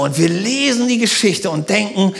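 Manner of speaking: ordinary talk, nothing marked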